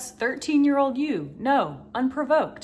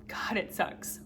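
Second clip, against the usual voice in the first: whispered aside; 8 dB quieter than usual. Real, not acted. Quiet aside about feeling small.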